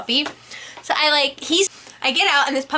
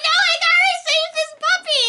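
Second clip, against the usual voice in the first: высокий упрямый выкрик; 12 semitones higher, faster than usual. Retold or imitated. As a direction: yell back, set on saving the puppy. Refusing mom, holding the puppy.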